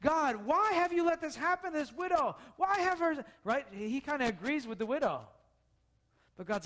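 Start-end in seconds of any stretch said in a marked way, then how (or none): 0.0-3.2 raised pleading prayer; crying out to God. Retold or imitated. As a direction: lift it up as an anguished plea, lightly. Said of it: "Elijah cries out to God and goes".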